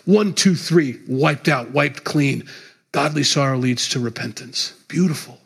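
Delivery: usual speaking voice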